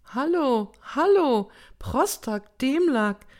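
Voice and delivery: high, loud distraught plea; agitated, high-pitched cries; desperate calling. A narrator's reading. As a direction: call out frantically for someone to listen. Grandmother calls desperately for help.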